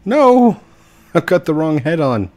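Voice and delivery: high, loud comic wail; mock dismay. Mock dismay at wrong avatar head.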